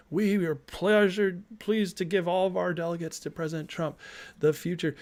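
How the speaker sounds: pompous convention delegate, half mocking; mock delegate speech. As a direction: lightly imitate a solemn delegate announcing the vote. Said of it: "they give a little speech and they say".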